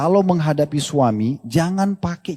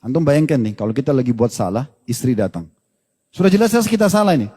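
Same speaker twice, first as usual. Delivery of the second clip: ordinary talk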